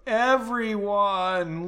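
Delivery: very slow, stretched-out moaning groan; drawn-out miserable groan; long groan. Acted. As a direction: drag it out like a groan. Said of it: "Leonid groaned".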